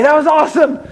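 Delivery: loud, high excited exclamation; thrilled shout. Retold or imitated. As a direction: shout it like you just got off the ride. Friend thrilled after roller coaster ride.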